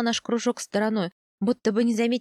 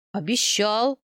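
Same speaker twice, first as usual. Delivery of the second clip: bewildered admission; confused confirmation; flustered; slower than usual. A narrator's reading. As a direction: admit it at a loss, tone rising. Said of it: "растерянно подтвердил мужчина".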